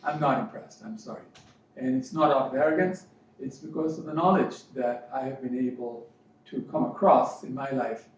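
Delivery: normal talking voice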